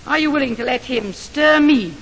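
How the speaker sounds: ordinary talk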